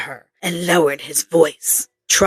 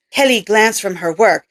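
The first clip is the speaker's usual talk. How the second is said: usual speaking voice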